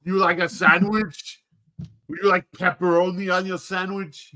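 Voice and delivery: high, heavily accented celebrity impression; Arnold. Offering a drive-thru customer a sandwich in a hammed-up Arnold impression. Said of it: "drive-thru as Arnold".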